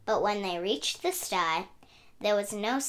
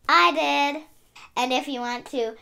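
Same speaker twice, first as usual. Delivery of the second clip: ordinary delivery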